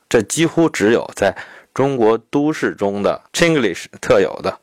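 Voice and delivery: ordinary talk, nothing marked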